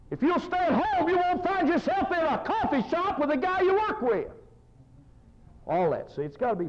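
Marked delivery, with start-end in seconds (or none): 0.1-4.3 high, impassioned pulpit preaching; high-pitched sermon delivery; full-force preaching. Real, not acted.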